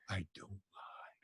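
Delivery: whispered, slow and low. Shown as a demonstration. Guest assuring the host his stories are true, whispered. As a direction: breathe it as a secret.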